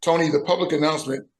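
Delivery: ordinary talk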